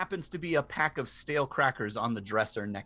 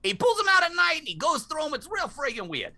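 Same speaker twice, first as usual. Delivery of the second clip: high squawky parrot; screechy bird voice; 5 dB louder than usual. Acted. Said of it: "I'm losing Iago".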